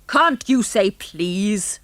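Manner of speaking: put-on great-grandad scolding the rude boy; gruff old grandad. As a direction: roar it like a cranky great-grandfather. Grandad roars from corner.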